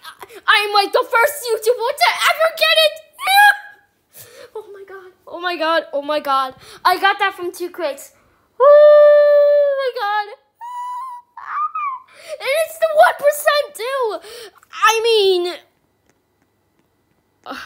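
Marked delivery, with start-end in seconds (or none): 3.2-3.7 high giddy laugh. Real, not acted. Giddy laugh after boasting. 8.6-14.5 amazed drawn-out cry. Real, not acted.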